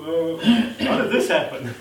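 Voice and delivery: laughing through the question; laughing disbelief. Retold or imitated. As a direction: chuckle out a stunned question. God mimics stunned believer.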